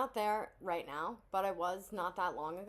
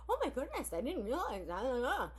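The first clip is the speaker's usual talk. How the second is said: mock shocked realization; 4 semitones higher than usual. Mocking therapists finally noticing, in fake surprise. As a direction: play the clueless professional suddenly shocked. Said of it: "And they're like".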